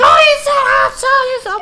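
piercingly high, loud, stretched-out squeal; excited woman mimicked. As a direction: shriek it slowly, mocking her delight. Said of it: "She was like".

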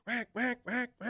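high, quick duck quacks by mouth; imitated alarm quack. Shown as a demonstration. Duck caller showing the host a hen's alarm quack, imitated quacking. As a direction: mimic a startled hen mallard.

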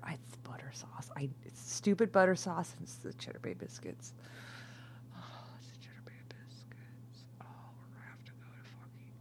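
breathy, hushed craving; whisper. Real, not acted. Drunk woman whispering her Red Lobster craving, hushed. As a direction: whisper it with longing.

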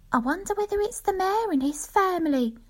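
speculating wife. Acted. Wife guessing who took the box, high musing voice. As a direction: muse it high and hopeful. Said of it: "speculating about that big private box".

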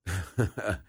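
brief uneasy-memory chuckle; a short, low-key laugh to oneself. Real, not acted. Recalling childhood punishment to the interviewer, short chuckle.

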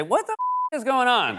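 high, bleeped exclamation of disbelief; shocked outburst. Pretend. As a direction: blurt it in disbelief. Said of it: "quote the great Edward R. Murrow".

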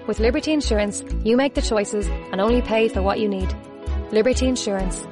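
ordinary talk, nothing marked

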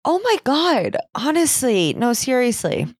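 high-pitched, indignant assent; agree, thoroughly fed up; exasperated agreement. Real, not acted.

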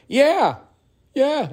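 put-on tiny, very high toddler yeah; toddler son. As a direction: squeak it like a little kid. Voicing the toddler's answer.

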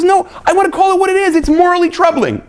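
hammed-up voice of the objector; voicing the objector. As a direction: speak up as the one who calls it what it is. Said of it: "One view says".

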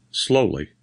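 usual speaking voice

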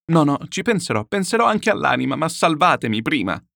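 high, loud, frantic insistence; frantic pleading. Acted. Chichikov desperate to be saved first, frantic high plea.

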